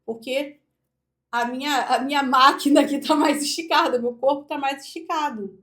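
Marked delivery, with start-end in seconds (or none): 1.3-4.0 louder, from a stretched-up body; demonstrating fuller projection; projected voice. Shown as a demonstration. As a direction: project from an open chest. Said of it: "eu projeto mais".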